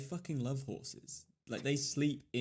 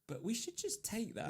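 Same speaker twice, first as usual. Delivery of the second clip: mock planner voice; 10 semitones higher, 4 dB quieter than usual. Mocking again, higher.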